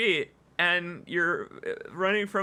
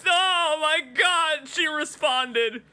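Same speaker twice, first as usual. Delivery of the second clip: delighted surprise; 9 semitones higher, slower than usual. Real, not acted. NPC answers greeting.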